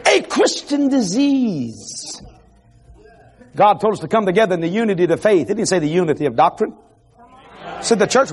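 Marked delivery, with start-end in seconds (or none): 0.0-2.2 slow intoning. Acted. Preacher intoning key phrase slowly.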